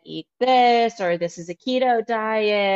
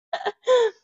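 high laugh. Real, not acted. Laugh alone on line.

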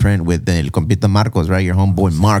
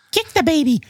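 squeaky, much higher cartoon voice; high squeaky quote. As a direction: shriek it like a cartoon kid. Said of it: "you said".

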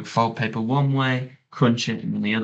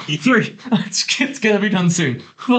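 mock rising panic; 10 semitones higher than usual. Imagined driver counting flips, building to a high panicked yell. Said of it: "I'd probably be like".

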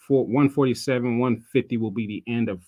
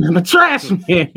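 laughing trash man line, slow and louder; mock the clip's guy through a laugh; higher, slowed trash man impression; 6 semitones higher, 10 dB louder than usual. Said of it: "you gotta say it like him".

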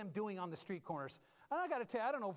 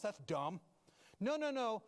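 ordinary talk

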